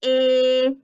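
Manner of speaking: "Ig" with long loud short-i, then separate g; stretched sounds. Shown as a demonstration. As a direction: hold the vowel, release the g apart. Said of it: "stretching the word out".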